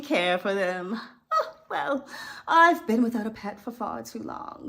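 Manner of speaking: purring villainous Cruella voice, sighs and laughs; Cruella audition read. Acted. Coach performs Cruella coveting the spotted puppies. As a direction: savour each word like a fashion villain. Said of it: "the scene might look like this".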